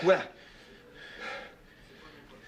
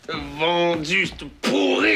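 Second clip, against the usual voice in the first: furious shout; 3 semitones higher, 5 dB louder, slower than usual. Acted. Cursing the traitor at the radio.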